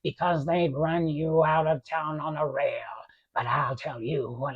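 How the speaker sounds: whispered, savoring the cruelty; gloating whisper. Acted. Potter whispers a gloating threat to George.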